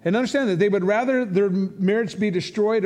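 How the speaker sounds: ordinary talk, nothing marked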